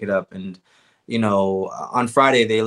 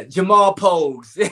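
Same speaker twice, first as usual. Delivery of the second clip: amused outburst; 8 semitones higher than usual. Real, not acted. Fighter throwing a colleague under the bus, laughing. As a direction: blurt the name, then crack up laughing.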